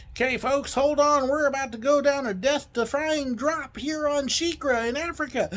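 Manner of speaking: high-pitched mock-alarmed announcement; mock-dramatic tour guide. Driver warning passengers of the drop, mock alarm. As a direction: hype a tiny thrill as mortal danger.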